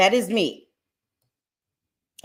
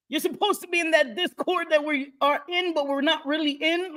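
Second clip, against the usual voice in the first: much higher playful scolding; teasing bestie; 9 semitones higher than usual. Retold or imitated. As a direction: tease the friend in a higher voice.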